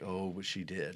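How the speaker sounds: a quiet, tender correction; soft tender reply. Retold or imitated. Father's tender reply quoted.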